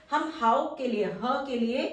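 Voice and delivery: ordinary delivery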